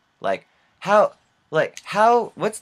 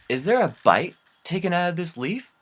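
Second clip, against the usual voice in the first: puzzled disbelief, pitch jumping high; incredulous question; 7 semitones higher than usual. Retold or imitated. Kyle inspects the gift leaf, incredulous.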